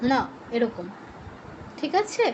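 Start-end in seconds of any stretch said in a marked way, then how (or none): 0.1-0.2 "ড়া" as retroflex ṇa, tongue curled back; retroflex nasal. Shown as a demonstration. Teacher closing the demo for students, "ড়া" retroflex. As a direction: fold the tongue to the palate on "ড়া". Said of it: "জিহ্বাটা fold করে মূর্ধায়".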